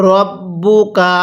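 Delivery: very slow "রব্বুকা", held double b; model recitation. Shown as a demonstration. Teacher models it for learners, slow measured "রব্বুকা". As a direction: stretch out "রব্বুকা" syllable by syllable. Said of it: "আমার সাথে পড়ুন".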